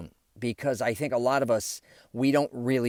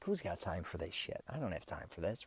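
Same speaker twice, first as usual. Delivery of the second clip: muttering; 4 semitones lower, 13 dB quieter than usual. Retold or imitated. Annoyed inner thought, muttered.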